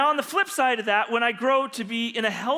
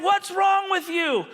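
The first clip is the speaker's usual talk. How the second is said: high, slow shout of a yelling cop; retold shout, pitched way up; angry yell; 10 semitones higher, 4 dB louder, slower than usual. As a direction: imitate an officer yelling in disbelief. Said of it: "he just keeps yelling me".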